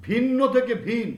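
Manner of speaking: ভিন্ন loud with ভি stressed; stressed first syllable. Shown as a demonstration. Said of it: "আদিতে যতটা শাসাঘাত দিয়েছিলাম". (ভি-তে জোর দিয়ে ভিন্ন)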